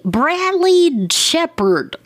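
high, slow, drawn-out guess at the name; stretching out a half-remembered name; wrong name blurted. Real, not acted. Podcaster straining to recall the actor, high drawn-out guess.

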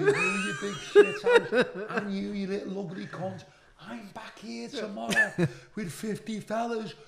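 high-pitched ranting threats, retold with laughter breaking in; loud, high acted tirade; berserk threats. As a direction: rant like you've gone berserk. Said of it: "Went berserk, Billy".

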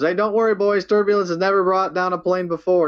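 lightly voiced calm pilot; imitating Murdoch. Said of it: "He goes".

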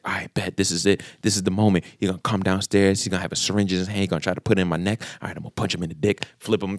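low, soft inner monologue, speeding up; tense self-talk. Retold or imitated. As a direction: psych yourself up under your breath. Retold bracing himself for an attack.

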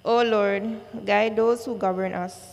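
ordinary delivery

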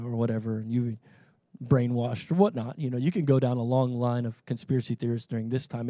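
ordinary delivery

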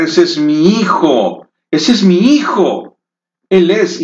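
put-on high-pitched pleading call of an old mother; mock cry of a mother. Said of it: "ella comienza a gritar". (grito de madre, agudo y suplicante)

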